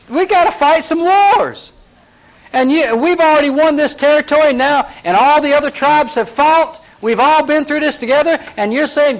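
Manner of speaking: high-pitched exasperated scolding; retold Moses outburst. Moses scolding Reuben and Gad leaders, high exasperated outburst. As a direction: let him blow up at the two tribes. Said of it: "How do you expect Moses to react?".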